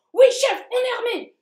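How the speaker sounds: high, loud soldier reply; soldier shout. Acted. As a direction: snap to attention and shout. Soldier answers roll call.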